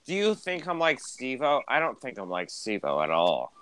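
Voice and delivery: usual speaking voice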